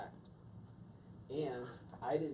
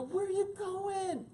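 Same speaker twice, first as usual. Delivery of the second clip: much higher, slow pleading call; calling after her; 4 dB louder, slower than usual. Retold or imitated. As his wife walks out, high calling question.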